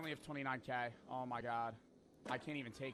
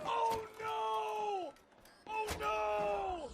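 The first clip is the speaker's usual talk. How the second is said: high, loud shriek of terror; terrified scream; 5 dB louder, much slower than usual. Real, not acted. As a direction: scream it as doom arrives. About to be caught.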